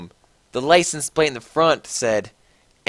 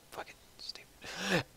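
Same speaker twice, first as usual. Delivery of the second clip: breathy whisper under the breath; a muttered whispered jab at the text; whispered aside; 5 semitones higher, 17 dB quieter than usual. Real, not acted. Reader whispering a comment on the fic's spelling.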